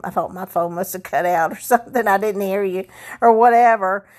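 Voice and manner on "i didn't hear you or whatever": normal talking voice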